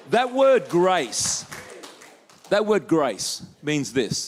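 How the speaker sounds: that word grace drawn out slowly, grace weighted; slow emphatic. Real, not acted. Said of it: "preacher stressing the word".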